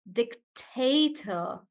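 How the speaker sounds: dictator slowly, TA stressed. Shown as a demonstration. Teacher breaking down dictator, slow with middle stressed. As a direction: stretch dictator, weight on the middle syllable. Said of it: "on te".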